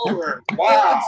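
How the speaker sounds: high, loud wow; awed cheer. Real, not acted. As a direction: react with real amazement. Host reacting to the poem.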